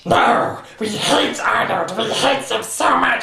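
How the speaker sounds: high, slow swooning parody; hammed-up love-hate schoolgirl voice; mock lovesick Helga. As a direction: swoon then sour in a silly high voice.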